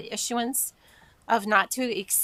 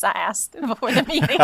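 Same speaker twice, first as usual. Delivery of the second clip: real laugh breaking through, louder and higher; laughing confession; 7 semitones higher, 8 dB louder than usual. Real, not acted. Chair admitting she asked about the name, laughing. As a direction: confess it, bursting into laughter at the end.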